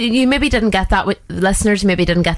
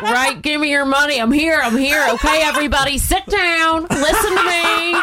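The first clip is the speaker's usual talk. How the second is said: higher, strained mock president voice; hammed-up impression, pitched up, stretching the ends of phrases; Donald Trump; 6 semitones higher than usual. Player robbing a bank as the president, high constipated-sounding impression. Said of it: "Oh, Donald Trump".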